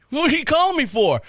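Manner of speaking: high, surprised question. Retold or imitated. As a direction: sound caught off guard by the call. Surprised to hear Mike.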